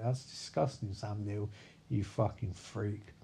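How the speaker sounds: low, soft, sinking disapproval; mock disgust. Crew recoiling from the captain's remark, low mock disgust. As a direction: drop low and quiet, slowing on the insult.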